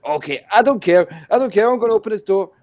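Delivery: loud, high, reckless insistence; drunk defiance. Retold or imitated. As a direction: act out a drunk friend's loud bravado. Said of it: "Kay was drunk".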